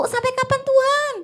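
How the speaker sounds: high, loud, mock-impatient cry to God; a believer losing patience, crying out to God; impatient plea to God. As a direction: whine it like someone fed up with waiting. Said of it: "dia tidak memberi batas waktu".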